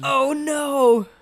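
loud, high-pitched mock horror; horrified dismay. Bard facing an awful demand, loud horrified wail. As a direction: wail it in exaggerated dread.

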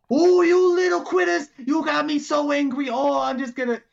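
high, loud put-on gator rage; hammed-up anger for show; mock super angry. Alligator fakes a fight for the crowd, mock fury. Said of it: "like I'm super angry".

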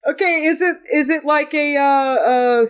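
high-pitched, halting question; lightly panicky, searching for a name; flustered character. Acted. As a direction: start high and grope for the word. Game master voices Carpathius returning.

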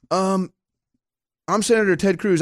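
normal talking voice

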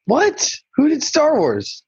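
high, startled disbelief; surprised. Real, not acted. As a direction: caught off guard, real surprise. Told Spielberg didn't do Star Wars.

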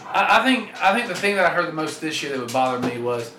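normal talking voice

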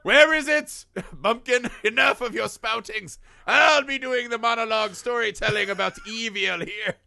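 high, loud, haughty dismissal; hammed-up condescending doctor; mock Loomis scoffing. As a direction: sneer down at the bumpkin.

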